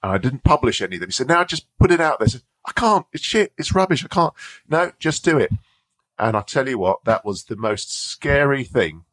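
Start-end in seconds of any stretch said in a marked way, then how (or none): 1.3-2.2 high, insistent push; imitated urging mentor. Mentor urging her to publish. 2.7-4.3 high-pitched, whiny self-doubt; mock panic, pleading to get out of it; retold panicky refusal. Acts out panicked refusal to publish. 4.7-5.5 high, firm dismissal; imitated insistent mentor. Mentor overrules her protest.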